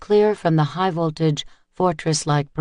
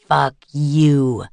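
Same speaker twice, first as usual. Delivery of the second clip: louder, seething retort; 5 dB louder than usual. A narrator's reading. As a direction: spit it back through rage. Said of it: "the thug seethed back".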